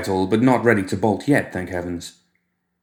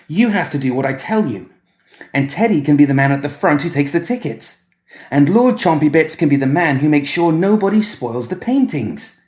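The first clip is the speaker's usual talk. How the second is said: put-on high, bossy little boy; bossy child; 7 semitones higher, 4 dB louder than usual. Boy giving Edmund and toys their jobs, high and bossy. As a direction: order everyone about like a small boy.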